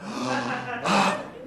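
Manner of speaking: hammed-up breathless gasp; high, exaggerated out-of-breath gasp; mock panting gasp. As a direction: gasp as if winded from rushing on. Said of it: "I'm sorry about this panting".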